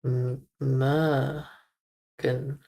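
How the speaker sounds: "Morgan" drawn out very slowly, syllable by syllable; slow careful. Shown as a demonstration. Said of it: "Mo is the sound".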